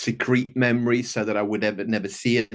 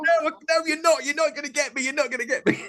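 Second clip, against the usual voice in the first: put-on much higher, quick playful kid protest; grandson squealing; faster than usual. Grandpa imitating his grandson dodging the head-slap joke, high and playful. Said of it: "He's like".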